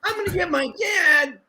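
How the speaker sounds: put-on very high, whining kid voice; mock tattletale child; whiny kid threat. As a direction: squeal it like a scared little kid. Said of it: "the guy who ran to get his dad".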